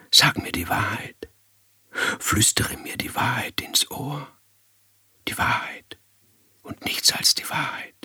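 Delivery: very soft, breathy urging; hushed, intimate demand, fading almost to breath; coaxing whisper. Acted. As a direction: draw the secret out in a whisper. Coaxing a secret into her ear.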